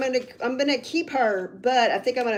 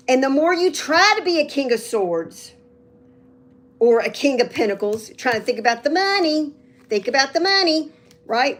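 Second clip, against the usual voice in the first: louder, rushing with excitement; excited and loud; 3 semitones higher, 6 dB louder than usual. Real, not acted. Tarot reader thrilled by her cards, getting loud. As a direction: caught up in the reading, loud. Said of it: "I'm getting loud because I'm getting excited".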